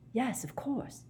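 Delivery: small, puzzled reply; slightly nonplussed. Acted. Harry answering Dumbledore, slightly nonplussed. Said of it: "slightly nonplussed".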